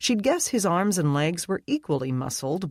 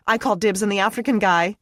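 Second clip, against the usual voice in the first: excited; 6 semitones higher, 6 dB louder, faster than usual. A narrator's reading. Brittany claiming a man.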